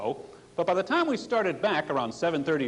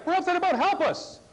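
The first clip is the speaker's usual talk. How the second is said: panicked cry for help; 11 semitones higher than usual. Retold or imitated.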